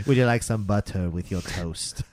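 low, soft, overly polite gentleman voice; distinguished gentleman. Retold or imitated. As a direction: drop low and quiet, terribly refined. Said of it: "He's a very distinguished gentleman".

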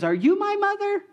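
put-on very high little baby bird voice; baby bird asking.

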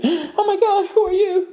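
high, gasping falsetto fright; mock scared woman. Woman waking to a stranger, high mock fright. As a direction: play a startled woman waking up. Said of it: "She's all scared and shit".

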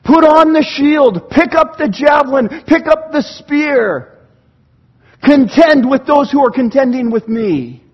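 loud, high, slow rallying plea; crying out. Acted. Preacher as David calling God to battle, crying out. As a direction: call up to God with drawn-out, forceful words. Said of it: "David is crying out like this".

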